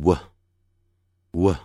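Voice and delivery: short, separated "w" with a brief neutral vowel; short W sound. Shown as a demonstration. Teacher modelling the W sound for learners, short and separated. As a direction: say "w" as a quick isolated consonant sound. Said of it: "念的时候念成".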